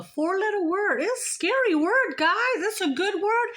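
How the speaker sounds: teasing, sing-song lilt up high; cutesy, high-pitched mock warning; playful high voice. Real, not acted. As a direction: tease it out in a high, swooping voice. Playful musing on the word love.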